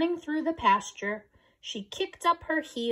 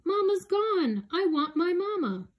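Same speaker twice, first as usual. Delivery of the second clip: tearful whimper; 10 semitones higher than usual. Acted. Calf finds pasture empty.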